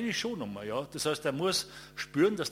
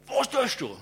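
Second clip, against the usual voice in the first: loud, high, demanding shout; angry yell; 12 semitones higher, 5 dB louder than usual. Retold or imitated. Hostile local yelling at the photographer, loud and high.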